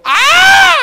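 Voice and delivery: very high, loud held whoop; imitated long holler; grandfather's arrival yell. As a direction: announce yourself with a piercing cry. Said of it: "se va escuchando que va llegando".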